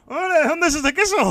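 high, loud chirpy mock customer. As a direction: ask like an eager stranger. Customer voiced in retold story. (imitando a un cliente curioso, agudo y alegre)

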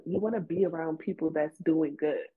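ordinary talk

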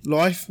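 "Life" said like "loif"; Australian-sounding vowel. Shown as a demonstration. Host checking his slip, "Life" said as "loif". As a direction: query "Life" with an Aussie vowel. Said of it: "you kinda said life".

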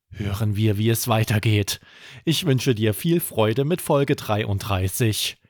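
plain reading voice

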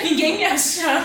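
laughing through the words. Real, not acted. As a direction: giggle while saying it. Laughs at her own fear.

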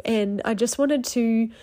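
normal talking voice

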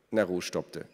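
plain reading voice

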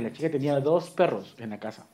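normal talking voice

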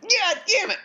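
high, hammed-up Boston accent; a Boston regular egging his buddy on. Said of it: "our best Boston accented".

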